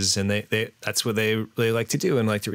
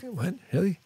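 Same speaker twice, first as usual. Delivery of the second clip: surprised; 10 semitones higher than usual. Retold or imitated. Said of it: "I'm like".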